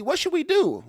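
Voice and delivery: high, loud imitated plea; acted high-pitched appeal for guidance; mock plea. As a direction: pitch it way up like helpless askers. Voicing people asking the SEC.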